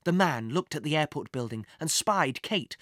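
plain reading voice

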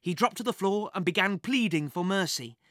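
ordinary delivery, nothing marked

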